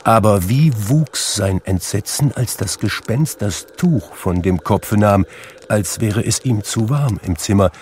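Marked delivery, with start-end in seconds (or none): none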